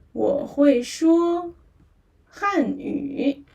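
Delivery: slow, drawn-out 我会说; slow model. Shown as a demonstration. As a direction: say 我会说 slowly for learners to repeat. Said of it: "let's say it together".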